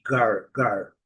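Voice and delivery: "gar" with the R clearly sounded; low drilled "gar", R audible; American R. Shown as a demonstration. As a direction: say "gar" curling the tongue into an American R. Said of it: "nos Estados Unidos ou Canadá".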